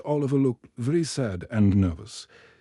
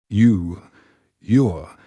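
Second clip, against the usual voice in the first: stammering; 3 semitones lower, 6 dB louder than usual. A narrator's reading. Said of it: "she stammered".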